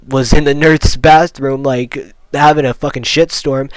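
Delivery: ordinary talk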